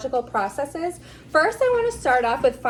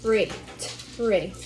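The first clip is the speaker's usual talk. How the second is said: "tree, tree." with a crisp, firm T; stress on the t; 3 semitones lower, 4 dB quieter than usual. Shown as a demonstration. Therapist models "tree" for Alex, T stressed. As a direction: snap the T of "tree". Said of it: "We really wanna emphasize the t".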